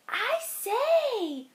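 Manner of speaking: high, soft little spider voice; a dainty tree spider speaking up; tiny spider voice. Acted. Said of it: "said the spider".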